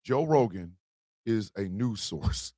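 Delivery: slow, weighty repeat of the phrase; slow and deliberate. Shown as a demonstration. Repeating the phrase to viewers, slow and deliberate. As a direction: spell out each word slowly. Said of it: "Let's say them five words again".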